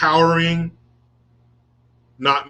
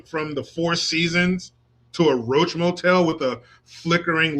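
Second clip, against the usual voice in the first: sarcastic mockery; 3 semitones higher than usual. Scene: host mocking Haitians who won't go home, sarcastic. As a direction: taunt them with mock sympathy.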